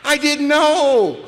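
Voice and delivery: very high, loud, slow gushing puppy voice; the thrilled puppy learning to poop outside; overjoyed puppy. Retold or imitated. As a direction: squeal with puppy delight. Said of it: "He'll go".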